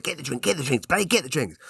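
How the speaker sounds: high-pitched, hurried-sounding mock order; mock urgent. Husband urging wife to grab free drinks, high mock urgency. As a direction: urge it in a high, pressed voice.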